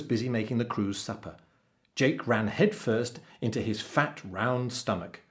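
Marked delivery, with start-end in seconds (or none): none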